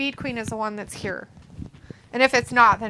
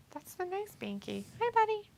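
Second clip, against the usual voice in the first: pet talk; 10 semitones higher, 6 dB quieter than usual. Real, not acted. Greeting the cat.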